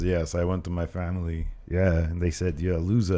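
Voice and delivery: put-on low, botched Austrian-style Bulgarian accent; badly faked Arnold-like Austrian accent; Austrian-sounding mock accent. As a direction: play a Bulgarian but land on Austrian. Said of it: "That's the wrong accent".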